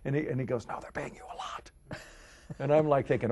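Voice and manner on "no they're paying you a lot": soft, voiceless whisper, imitating dad; insistent whisper. Dad correcting his son about the money, emphatic whisper. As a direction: whisper it to make the kid understand.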